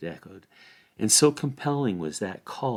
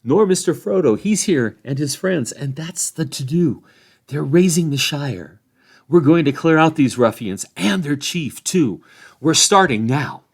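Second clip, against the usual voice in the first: Sam, keen and louder, rallying; determined young hobbit; 6 semitones higher, 8 dB louder than usual. A narrator's reading. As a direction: announce the fight with pride. Sam tells Cotton of the rising.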